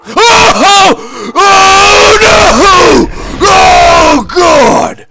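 high, loud drawn-out cries; long shouts of dismay; panicked wailing. Real, not acted. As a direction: wail in horror, high and loud. Screams in game.

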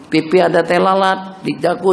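normal talking voice

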